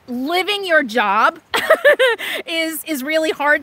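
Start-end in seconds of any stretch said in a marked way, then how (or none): 1.5-2.4 high burst of laughter mid-sentence; real high, quick laugh; rueful laugh. Real, not acted. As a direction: break into giggles at your own remark. Laughs at living your job.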